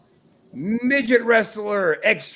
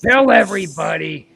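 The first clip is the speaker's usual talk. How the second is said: wrestling promo; 7 dB louder, slower than usual. Pretend. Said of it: "Just cut a promo, pal".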